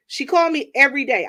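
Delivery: ordinary delivery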